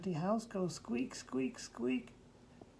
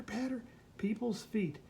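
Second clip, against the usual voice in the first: ordinary delivery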